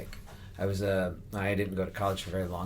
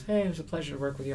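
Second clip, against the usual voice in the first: high, quick, superficially nice pleasantry; breezy insincere courtesy, pitched up; polite but not genuine; 7 semitones higher, faster than usual. Retold or imitated. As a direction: toss it off nicely without really meaning it. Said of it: "he's very nice, but it wasn't, uh, genuine".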